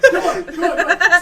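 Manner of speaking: high, loud beckoning; high frantic come on; urgent calling. Acted. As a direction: hurry them along, shouting. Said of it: "he's like".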